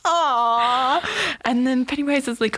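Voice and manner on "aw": higher, drawn-out pitying coo; mock-tender melting reaction; sympathetic aw. Viewer touched by the little boy, sympathetic.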